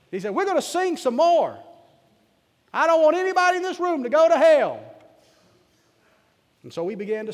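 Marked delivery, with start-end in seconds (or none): 0.3-4.8 high, loud country preacher's cry; preaching shout. Retold or imitated. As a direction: belt it out like a mountain lay preacher. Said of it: "I don't suppose I should preach like that here".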